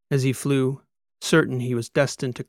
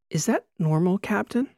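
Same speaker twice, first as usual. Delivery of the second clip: a hesitant, higher voice, asking timidly; diffident tourist; 8 semitones higher than usual. A narrator's reading. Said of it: "She spoke with such diffidence".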